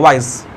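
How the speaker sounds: वाइज said as one unbroken syllable; one quick falling beat on वाइज. Shown as a demonstration. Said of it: "एक ही बार में बोल रहे हैं".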